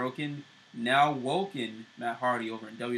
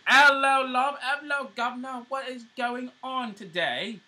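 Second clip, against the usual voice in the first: put-on over-the-top Cockney British accent, much higher and louder at the start; a hammed-up, clearly fake Cockney accent pitched way up; bad Cockney accent; 13 semitones higher, 8 dB louder than usual. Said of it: "really weird Cockney British accent".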